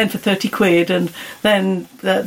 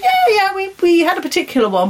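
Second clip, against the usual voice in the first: quoted lady, much higher and quicker; excited retelling; 9 semitones higher than usual. Retold or imitated. Guest retells the cruise lady's eager reply, voice higher. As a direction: bubbly mimic of the cruise lady. Lady on ship recalling lecturer.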